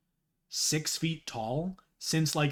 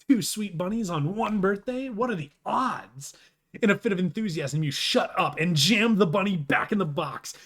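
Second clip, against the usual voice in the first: put-on higher, louder excited kid voice; upbeat character read; 5 semitones higher, 6 dB louder than usual. Streamer reading Homestuck, an excited kid voice. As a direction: read it like a giddy birthday kid.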